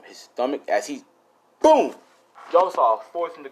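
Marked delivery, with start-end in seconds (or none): 1.6-1.9 loud high boom sound effect; shouted boom. Retold or imitated. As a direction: yell the impact noise. Sound effect in fight story.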